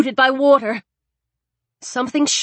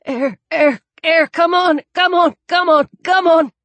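breathless pleading, rising in pitch and louder; a drowning man begging for air; desperate for air; 5 semitones higher, 3 dB louder than usual. Acted. Drowning under the ice, he pleads desperately for air.

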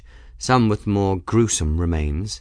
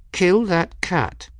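slow, menacing growl, pitched up; threatening growl; 10 semitones higher, slower than usual. A narrator's reading. Bridget straining on the rope, threatening growl. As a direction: growl it out slowly with effortful threat. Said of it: "Bridget managed to growl".

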